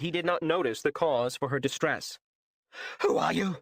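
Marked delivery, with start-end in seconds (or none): 3.0-3.6 woman startled by an intruder in her office; startled shriek. A narrator's reading. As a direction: gasp it out in fright at a stranger. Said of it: "the woman shrieked into the man's ear".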